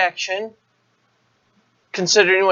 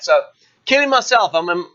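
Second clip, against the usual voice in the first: ordinary talk, nothing marked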